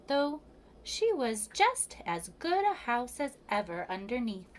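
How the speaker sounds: plain reading voice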